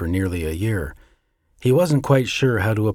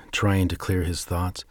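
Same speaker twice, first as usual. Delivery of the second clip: ordinary delivery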